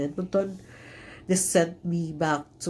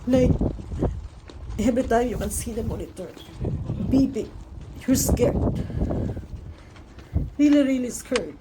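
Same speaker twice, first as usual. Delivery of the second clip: choked up; 7 semitones higher than usual. Real, not acted. Mother recalling the monitor beeping, on the edge of crying. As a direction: push through rising real tears. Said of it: "I'm so emotional".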